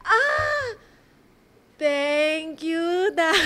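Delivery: high, strained fake gratitude with a little laugh; pretending to be pleased with a bad gift; forced thank you. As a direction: force out a polite thank you you don't mean. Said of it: "Baka kailangan ko pa mag-acting na".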